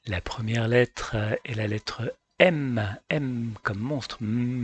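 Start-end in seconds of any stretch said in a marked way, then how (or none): 4.2-4.6 M as a humming mmm; letter sound M. Shown as a demonstration. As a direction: say M with closed lips, held. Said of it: "La bouche est fermée". (M prononcé « mmm », bouche fermée)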